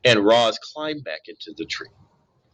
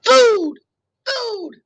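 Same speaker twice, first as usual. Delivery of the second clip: gosling peep; 6 dB louder than usual. Acted. Hungry gosling demands food.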